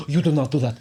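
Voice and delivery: Russian accent impression. Music teacher scolding kids in a loud mock Russian accent. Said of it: "in this Russian-sounding accent".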